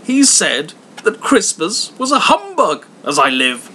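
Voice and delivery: cried out high and loud through laughter; laughing outcry. A narrator's reading. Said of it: "cried Scrooge's nephew".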